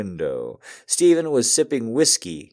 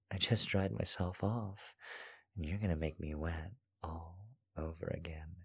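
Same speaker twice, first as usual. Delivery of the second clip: hushed, much softer tease; soft teasing; 2 semitones lower, 16 dB quieter than usual. Acted. Cassandra teasing Stephen while kissing him, hushed.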